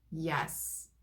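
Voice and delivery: usual speaking voice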